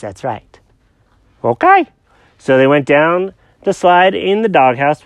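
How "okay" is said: high-pitched confused cat; squeaky cartoon cat, lost and puzzled. Jenkins voice.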